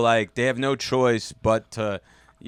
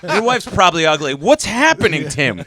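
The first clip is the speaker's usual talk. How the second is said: high, louder runaway insult rant; mock rant; 9 semitones higher, 7 dB louder than usual. Host imitating Tim insulting a producer, mock rant. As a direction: gleefully pile on insults. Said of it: "You're like".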